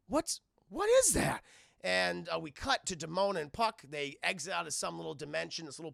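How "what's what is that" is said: high, louder startled question; alarmed puzzlement. Retold or imitated. Gargoyles looking down at the ground, high startled question. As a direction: act out a startled double take. Gargoyles spot something below.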